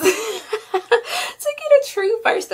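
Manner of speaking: high, laughing speech; laughing through words. Real, not acted. Vlogger opening the scrub, laughing as she talks. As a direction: talk while giggling.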